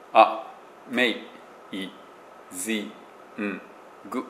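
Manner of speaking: あ、めい、ずい、ん、ぐ split apart, each syllable separate. Shown as a demonstration. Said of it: "全部母音で終わる". (音節ごとに区切って)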